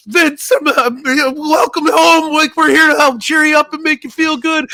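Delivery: very high, loud, goofy cheer-up voice; loud falsetto caricature, dragged-out welcome; Mankind. Retold or imitated. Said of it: "Mankind comes walking in with the balloons".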